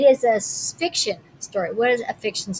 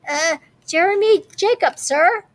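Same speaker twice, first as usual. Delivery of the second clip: put-on higher, polite kid's voice; boy answering; 7 semitones higher, 3 dB louder than usual. A narrator's reading. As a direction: answer the captain shyly. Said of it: "I told him".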